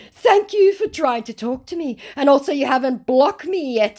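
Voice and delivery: high, loud pleading thanks; mock grateful scammer. Scammer thanks her for not blocking him, overdone gratitude.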